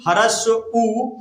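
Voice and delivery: ह्रस्व उ stretched out slowly for the class; teacher listing vowels, ह्रस्व उ said very slowly; slow demo. Shown as a demonstration. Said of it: "ह्रस्व स्वर में क्या क्या आता है".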